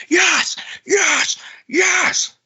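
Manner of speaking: high-pitched victory shout; re-enacted celebration yell. Fan alone in the car as the goal ties it, celebration yell. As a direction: shout it high, one burst after another. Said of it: "I'm sitting in my car, just".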